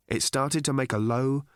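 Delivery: ordinary delivery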